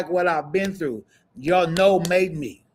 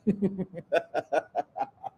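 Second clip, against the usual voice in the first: laugh; 5 semitones higher, 7 dB quieter than usual. Real, not acted. Laughs at own joke.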